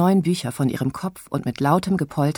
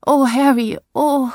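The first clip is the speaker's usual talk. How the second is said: high, loud melodramatic sigh of oh; stagey swooning cry; theatrical lament; 10 semitones higher, 6 dB louder than usual. Acted. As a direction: play the tragic heroine, hammed up. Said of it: "rief Selina theatralisch".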